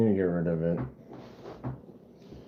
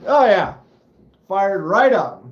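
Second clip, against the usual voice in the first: higher and louder, thrilled it fired up; excited; 7 semitones higher, 8 dB louder than usual. Retold or imitated. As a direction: cheer as the engine catches. Excited as engine starts.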